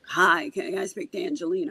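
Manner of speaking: imitating a gravelly-voiced caller. Mother phones the medium, lightly gravelly voice. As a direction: put a touch of gravel in the voice. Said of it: "had a very gravelly voice".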